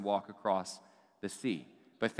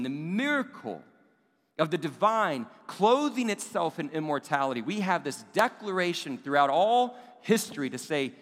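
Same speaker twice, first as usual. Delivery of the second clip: higher and louder, full-force sermon build; preaching climax; 4 semitones higher, 4 dB louder than usual. Acted. Preacher proclaiming Advent to congregation, rising and forceful. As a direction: drive it home to the congregation.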